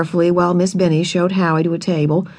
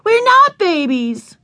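much higher, slow and drawn out; indignant yell; 12 semitones higher, slower than usual. A narrator's reading. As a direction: shout back at the big boys. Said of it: "Ramona yelled back".

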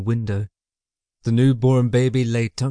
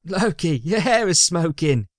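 higher-voiced amused sidekick; high, snickering character voice; snickering companion; 7 semitones higher than usual. A narrator's reading. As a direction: tease him in a raised voice. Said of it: "His companion snickered".

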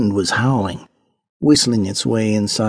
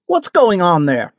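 raised, higher demanding call; authoritative cry; 8 semitones higher than usual. A narrator's reading. Marquess questioning the crowd by the river, authoritative cry. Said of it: "cried in an authoritative voice".

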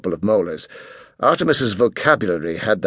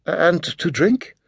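timid waitress; 7 semitones higher, slower than usual. A narrator's reading. Waitress voiced, slow, far.

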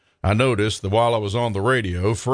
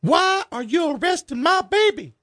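much higher, shrill outraged mama; shrieking mother. Acted. Darla screeching at the cop over her son's arrest, high and outraged.

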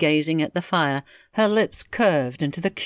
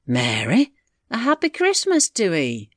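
higher, lilting greeting; lightly voiced country aunt; young aunt's voice; 5 semitones higher than usual. Aunt wishing Mary happy Christmas, in a younger voice. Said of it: "like the voice of the young aunt".

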